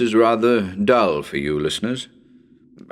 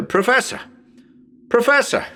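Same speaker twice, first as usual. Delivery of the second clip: calling a name high, with a laugh on the second call; calling the professor again, amused by the echo; 13 semitones higher than usual. Acted.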